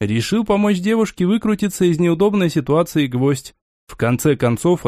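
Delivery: ordinary delivery, nothing marked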